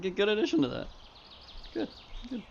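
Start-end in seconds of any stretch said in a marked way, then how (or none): none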